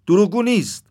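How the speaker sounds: high, loud objection; loud protest. A narrator's reading. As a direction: cut in sharply to defend someone. Second crow cuts in with objection.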